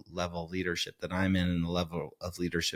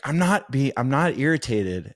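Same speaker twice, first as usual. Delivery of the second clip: higher, louder snapping denial; irritated denial; 7 semitones higher, 5 dB louder than usual. Retold or imitated. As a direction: bite back that she is not irritated. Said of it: "she's like".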